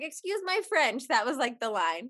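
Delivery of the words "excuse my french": high, slow, cheeky excuse-my-French; a prim little afterthought after swearing; mom's sly apology. Retold or imitated. Imitating mom excusing her swearing to the kids, high and slow. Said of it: "she'd be like".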